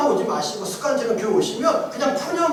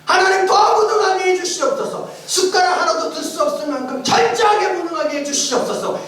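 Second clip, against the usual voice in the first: fervent prayer; 7 semitones higher than usual. Retold or imitated. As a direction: pray it out with fervour. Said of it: "이렇게 기도하기 시작했습니다".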